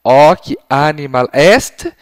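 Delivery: slow, loud, drawn-out answer pattern; teacher giving the answer pattern, slow and loud; slow prompt. Shown as a demonstration. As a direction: leave the end open for the child to finish. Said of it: "dê o padrão de resposta".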